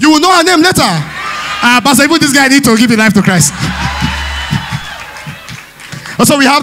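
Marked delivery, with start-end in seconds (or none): 3.6-5.5 high shrieking laugh. Real, not acted. Crowd laughter burst.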